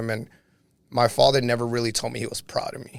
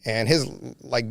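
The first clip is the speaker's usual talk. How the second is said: ordinary talk, nothing marked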